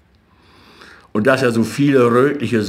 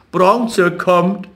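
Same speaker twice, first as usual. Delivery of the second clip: higher, sing-song taunt on Bronze; taunting call; 8 semitones higher, 4 dB louder than usual. A narrator's reading. Street boys teasing Jakob, sing-song calling. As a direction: call it out mockingly. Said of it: "die Straßenjungen riefen".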